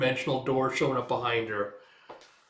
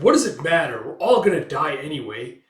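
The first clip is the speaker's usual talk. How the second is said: much louder, mock hopeless; despairing shout; 3 semitones higher, 10 dB louder than usual. Giving up on the group, yelling. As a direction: throw up your hands, loudly.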